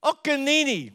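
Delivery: put-on high little-girl chant; high, insistent toddler imitation. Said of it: "like this".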